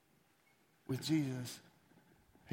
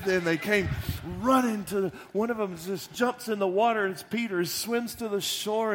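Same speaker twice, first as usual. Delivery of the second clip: louder, higher and rushing; excited storytelling; 4 semitones higher, 7 dB louder than usual. Real, not acted. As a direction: tell it like it is thrilling news. Preacher excitedly tells the rush.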